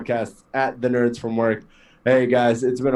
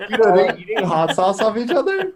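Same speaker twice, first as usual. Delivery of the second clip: laughing; 7 semitones higher than usual. Real, not acted. Amused disbelief at hot sauce.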